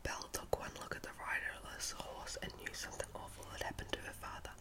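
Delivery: ordinary delivery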